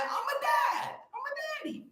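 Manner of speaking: way up high and squeaky, almost a shriek; squeaky high dad claim. Pretend. Playfully telling livestream viewers he's a dad, squeaky high. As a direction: squeal it out like a cartoon.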